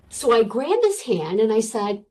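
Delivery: ordinary talk, nothing marked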